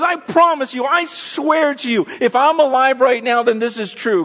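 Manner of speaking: God swearing to his people, loud and high; God pleading. Retold or imitated. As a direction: speak as God, insisting passionately. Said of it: "he goes".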